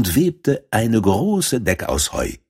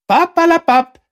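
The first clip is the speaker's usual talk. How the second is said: very high, louder brushing-off squeak; dismissive field mouse; 6 dB louder than usual. Acted. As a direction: wave away her objection like a bossy little mouse. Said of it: "sagte die Feldmaus".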